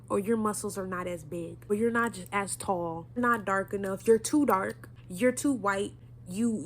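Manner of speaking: usual speaking voice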